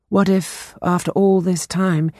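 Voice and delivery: ordinary delivery, nothing marked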